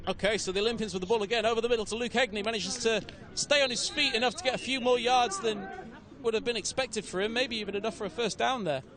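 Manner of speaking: quick, lifted commentary call; play-by-play. Acted. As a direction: call the action live.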